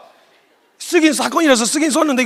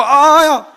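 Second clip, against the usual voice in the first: high, loud acted ouch; drawn-out comic cry of pain; mock yelp of pain; 6 semitones higher, 6 dB louder, much slower than usual. Servant Malchus struck on the ear, yelping.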